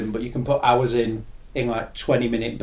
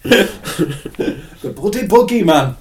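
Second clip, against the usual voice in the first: put-on slow, laughing northern accent, louder; mock northern accent; 6 semitones higher, 6 dB louder, much slower than usual. Imagining the boogeyman as a northerner, slow laughing northern accent. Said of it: "The boogeyman sounds horrible in a northern accent".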